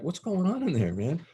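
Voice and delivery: high-pitched mock bewilderment; baffled question. Acts out baffled reaction at door.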